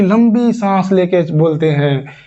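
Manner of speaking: ordinary delivery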